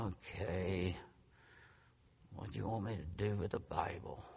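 meek, very soft reply; scolded little boy. Retold or imitated. Husband scolded by his wife, meekly giving in. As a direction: give in sheepishly, low and small. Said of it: "I felt like a little boy".

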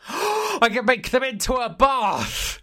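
sudden high excited realisation; excited gasp. Pretend. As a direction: gasp and announce it slowly. Mock excited Weetabix bath idea.

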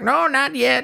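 high-pitched hobo reply; skinny little hobo. Acted. Hobo answering Arthur, high put-on voice. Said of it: "like, a skinny little hobo".

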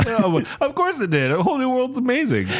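sarcastic. Pretend. Mock outrage over the vote.

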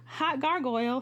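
a playful ogling, hot gargoyle; excited hooting. Retold or imitated. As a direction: hoot it out like spotting a hunk. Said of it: "And I was like".

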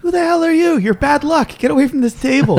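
high, fast, hostile snapping; angry gambler. Acted. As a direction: shoo him off the table. Gambler yells at intruder.

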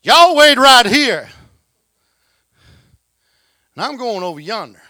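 slow, high, loud Texan drawl on y'all; broad Texan drawl. Shown as a demonstration. Preacher voices Jesus to disciples in broad Texan. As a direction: stretch it out like a real Texan. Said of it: "Am I saying it right?".

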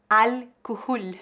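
careful Arabic-style al-kuhul; Arabic word. Shown as a demonstration. Teacher giving the Arabic original, carefully. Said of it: "vou dar alguns exemplos de palavras árabes".

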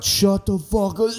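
mock puking through the words; retching mid-sentence. [name] pukes while telling his friend off, mock retching. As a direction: break off into a throaty heave. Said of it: "it was just like that".

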